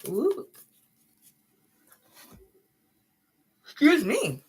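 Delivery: words stretched through a yawn. Real, not acted. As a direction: excuse yourself mid-yawn. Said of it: "when I yawn, I cry".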